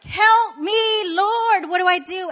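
high, loud mock-desperate cry for help; desperate plea. Overwhelmed mom begging the Lord for help, desperate. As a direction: plead upward, hammed-up. Exasperated mom pleading to God.

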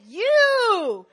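very high, loud accusing shout; furious yell. Retold or imitated. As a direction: hurl the word at him. Yelling at cheating boyfriend.

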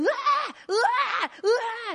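high mock baby crying wah; imitated baby wail.

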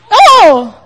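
real surprised yelp; startled. Real, not acted. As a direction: caught off guard by something. Startled mid-sentence.

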